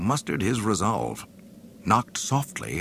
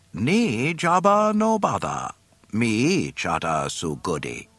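alien voice; 5 semitones higher, 4 dB louder than usual. Acted. Bib Fortuna speaking.

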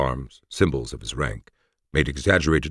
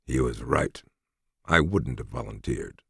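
weary soldier, resigned and soft; tired voice; 2 semitones lower, 4 dB quieter than usual. Acted. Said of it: "said Felix in a tired voice".

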